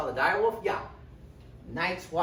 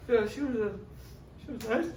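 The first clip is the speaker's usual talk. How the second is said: higher, hesitant fib; lightly voiced uneasy Jon; awkward reply; 8 semitones higher, faster than usual. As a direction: cover it up awkwardly. Jon lying about mom.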